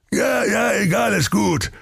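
hoch und abwinkend; ungeduldiger König. Acted. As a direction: genervt abwimmeln. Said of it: "sprach König Schweinebier". (ungeduldiger König)

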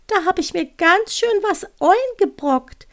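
high-pitched, rueful little owl. Acted. Owl owning up to its mess, high little creature voice. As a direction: lament it in a high, cute owl voice. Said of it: "hörte sie die Stimme weiterreden".

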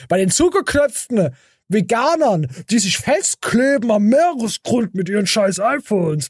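high, mocking put-on voice. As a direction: sneer at them in a prissy voice. Mocking uptight vegans.

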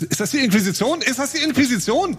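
frightened questioning; panicked. Acted. Character panics at intruders.

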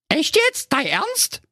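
very high squeaky disbelief; bewildered little princess squeak; astonished. Acted. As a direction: question daddy in piping amazement. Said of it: "fragte Carola verwundert".

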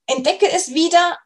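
ordinary talk

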